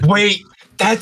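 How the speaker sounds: high-pitched burst of surprise; startled realization. Real, not acted. Listener recognizing a colleague's voice at last, real surprise. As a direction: blurt it out as it suddenly clicks.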